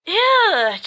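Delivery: high, loud ew; disgusted ew. Acted. Friend reacting to the witch in the coffin, loud disgust. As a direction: recoil in disgust.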